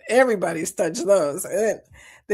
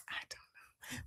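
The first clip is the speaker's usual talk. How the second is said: much softer, whispered aside; 27 dB quieter than usual. Real, not acted. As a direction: breathe it to yourself.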